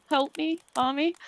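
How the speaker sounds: mock little kid. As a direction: pretend to be a scared child calling mommy. Teasing the scared child moment.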